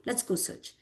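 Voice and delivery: whispered almost without voice. Retold or imitated. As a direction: breathe it, soft and resolved. Rama's resolve, said twice.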